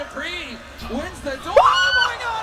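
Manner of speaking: screaming play-by-play, high and loud; casting hype. Real, not acted. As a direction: let the play-by-play explode as Dupree wins.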